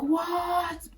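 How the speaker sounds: high, drawn-out incredulous cry; stunned disbelief. Retold or imitated. Intern hears the job offer, stunned disbelief. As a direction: react in high-pitched amazement.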